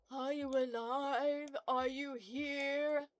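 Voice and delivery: slow, drawn-out mock medium calling the dead; hammed-up ghost hunter summoning spirits; spooky séance voice.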